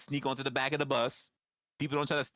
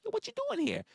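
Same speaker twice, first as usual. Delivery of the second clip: put-on high, sneering young punk; much higher taunting "Yo"; mocking youngster; faster than usual. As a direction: jab it like a cocky kid. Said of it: "the younger people are like".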